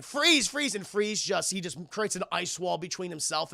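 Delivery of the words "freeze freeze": higher, pleading call of the name; young hero calling to the doomed villain; urgent call. Acted. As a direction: call out to stop him. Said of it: "And he's like".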